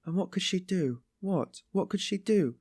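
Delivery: high-pitched, persistent questioning; hot and excited. A narrator's reading. Sonia persists in defending her, getting heated. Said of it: "getting hot and excited".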